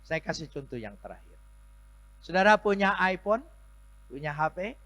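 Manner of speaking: high, loud sermon; full-force preaching. Acted. Pastor exhorting the congregation, high and loud. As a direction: rouse the congregation.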